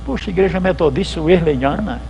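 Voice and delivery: ordinary delivery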